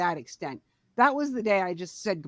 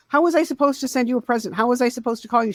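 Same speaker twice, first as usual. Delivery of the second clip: higher, louder, fast retort; exasperated protest; 4 semitones higher, 7 dB louder, faster than usual. Retold or imitated. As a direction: argue back in exasperation, loud and quick. Acted protest to Mom on phone.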